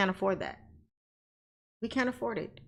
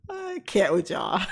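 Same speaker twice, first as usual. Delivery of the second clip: laughing; slower than usual. Real, not acted. Amused by the chat, laughing slowly.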